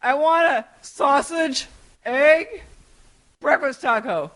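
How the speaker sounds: imitating a husband yelling an order; mock yelled order. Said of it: "he's like".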